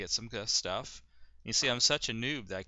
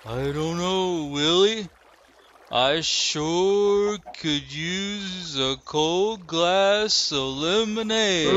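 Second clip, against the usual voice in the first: very slow, drawn-out backwoods drawl, much higher; Mountain Man voice; 8 semitones higher, 11 dB louder, much slower than usual. Pretend. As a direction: drag every word out like Mountain Man. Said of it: "I sound like Mountain Man".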